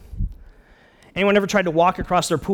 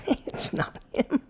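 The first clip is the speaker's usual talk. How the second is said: very high, comic voice of disbelief; doubting inner thought; slower than usual. Acted. Peter privately doubts it is Jesus, comic high-pitched disbelief. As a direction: squeak out the private doubt, half laughing. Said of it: "Probably thinking".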